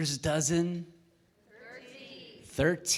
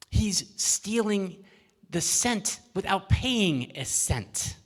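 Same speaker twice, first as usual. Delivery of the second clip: slow solemn pronouncement; 6 semitones higher, slower than usual. Acted. Judge pronounces the pun.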